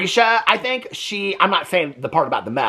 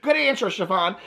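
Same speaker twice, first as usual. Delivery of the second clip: higher, lightly mocking team cheer; game show contestant; 7 semitones higher than usual. Mocking parents praising Chiffon, playful game-show cheer. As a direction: imitate contestants repeating encouragement. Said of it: "Family Feud".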